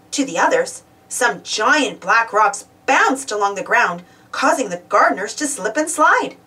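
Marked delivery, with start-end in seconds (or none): none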